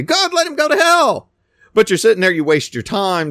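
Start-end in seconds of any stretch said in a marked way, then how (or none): 0.0-1.3 high, loud sneering "let him go to hell"; mock outraged believer. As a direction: ham up an offended churchgoer's outburst. Mocking an offended believer.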